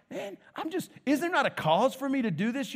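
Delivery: high-pitched, aggrieved objection; acted protest. David protests to his scolding brother.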